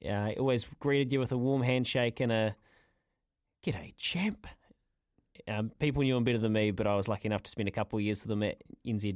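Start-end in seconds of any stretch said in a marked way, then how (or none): 3.6-4.5 soft whispered G'day, champ; hushed friendly greeting, almost whispered; a whispered imitation of his old greeting; breathy greeting.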